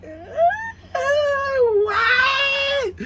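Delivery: very high drawn-out squeal; exaggerated squealed "what"; mock squeal. Mock reaction to being let through.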